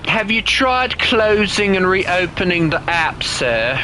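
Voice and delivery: slow put-on British accent; mock-polite British helpdesk voice. Helping someone with an app, in a mock British accent. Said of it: "how much do you hate my British accent".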